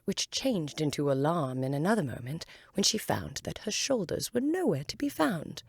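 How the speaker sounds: ordinary delivery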